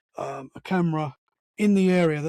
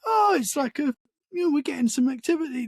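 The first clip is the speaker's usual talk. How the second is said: mock excited; 8 semitones higher than usual. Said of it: "It wasn't like".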